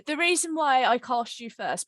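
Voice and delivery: ordinary talk, nothing marked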